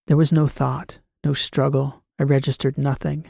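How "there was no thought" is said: plain reading voice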